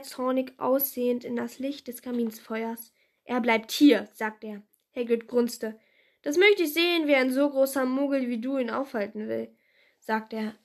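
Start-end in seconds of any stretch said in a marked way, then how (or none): 3.2-4.1 angry. A narrator's reading. Vernon angry, zornig aussehend. 6.2-9.5 mocking. A narrator's reading. Hagrid mocks Vernon.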